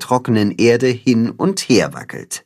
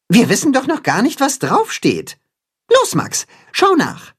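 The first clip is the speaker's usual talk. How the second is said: much higher, eager hero voice; urging Timme; 13 semitones higher than usual. A narrator's reading. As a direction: push your friend to go look.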